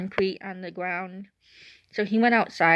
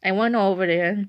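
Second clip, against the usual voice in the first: usual speaking voice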